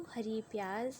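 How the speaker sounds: usual speaking voice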